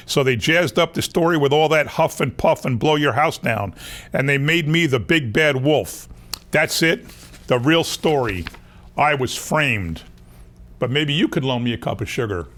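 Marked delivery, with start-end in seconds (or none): none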